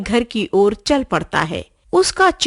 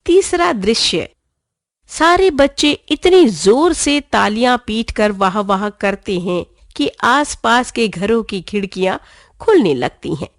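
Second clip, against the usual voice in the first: ordinary delivery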